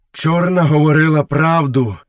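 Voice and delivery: ordinary delivery, nothing marked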